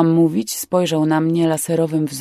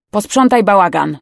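louder, barked command; mild yelled order; 2 semitones higher, 7 dB louder than usual. A narrator's reading. Older man to the young one, mildly yelled order. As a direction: order him about, raising your voice a little. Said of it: "wrzasnął do młodego".

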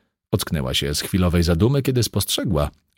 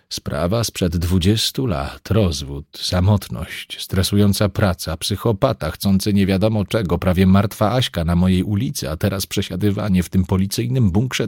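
normal talking voice

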